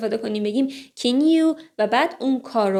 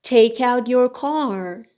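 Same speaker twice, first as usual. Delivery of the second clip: usual speaking voice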